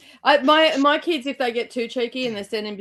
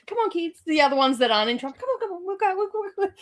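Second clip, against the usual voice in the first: put-on calm dad herding kids; 7 semitones higher, faster than usual. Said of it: "he's like".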